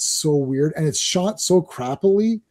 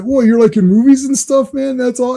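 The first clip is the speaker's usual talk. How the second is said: retold wide-eyed amazement, higher and louder; Terry; 7 semitones higher, 4 dB louder than usual. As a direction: gush like an impressed simple dude.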